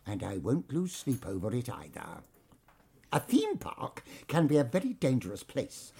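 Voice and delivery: soft, low, half-whispered warning; menacing hushed warning; low menace. Acted. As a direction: chill them quietly.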